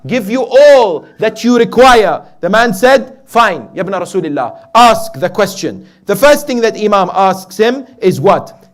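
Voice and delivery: loud, high, emphatic sermon delivery; full-force preaching. Real, not acted. Preacher retells Imam's offer of three questions, full-force preaching. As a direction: build the bargain with ringing emphasis.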